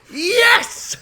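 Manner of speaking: high excited cheer; cheering yes. Retold or imitated. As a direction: cheer it like a delighted neighbour.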